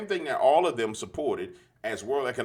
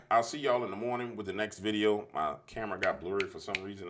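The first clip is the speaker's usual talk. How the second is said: ordinary talk, nothing marked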